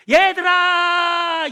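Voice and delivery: very slow, loud, high far-off shout; calling demon. Retold or imitated. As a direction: call your friends from afar like a demon. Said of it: "귀신들". (멀리 있는 귀신들을 부르는 외침)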